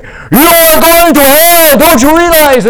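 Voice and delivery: slow, very high, very loud hammed-up yelling; imitated shout. Missionary imitating Chokwe role play shouting at a non-believer. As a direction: scream it at them, stretched out. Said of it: "they shout".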